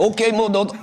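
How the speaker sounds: a touch of a fake African accent. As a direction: voice him in a put-on African accent. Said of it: "doing a fake African accent".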